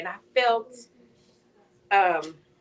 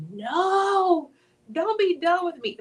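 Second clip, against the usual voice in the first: pleading; 8 semitones higher, much slower than usual. Retold or imitated. Retold plea to God.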